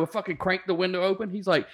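usual speaking voice

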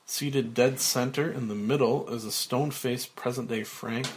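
plain reading voice

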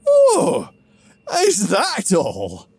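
high, loud mock disbelief, slow on the question; sarcastic. Grog mocking Theethum's mountain plan, loud sarcastic disbelief. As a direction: drip sarcasm at the absurd request. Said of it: "I'm sensing a little sarcasm".

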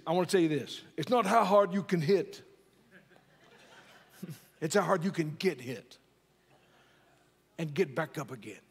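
put-on a light, half-hearted Brooklyn accent as Rocky; bad Rocky impression. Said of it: "That's not a very good Rocky".

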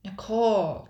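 loud, high scolding cry of a name; reprimanding. A narrator's reading. Brother scolds Nicole for provoking pirates, reprimanding. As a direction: pull a sister up sharply. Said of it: "Jasper reprimanded".

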